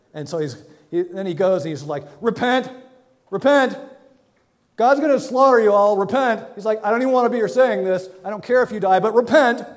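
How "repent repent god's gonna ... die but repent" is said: higher, louder, half-hearted mocking yell; resentful shouting prophet. Reluctant Jonah yelling at Nineveh, grudging shout. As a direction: bark repent at them like you hate it. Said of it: "That's basically his attitude in this whole thing".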